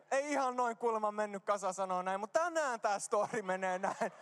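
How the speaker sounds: ordinary delivery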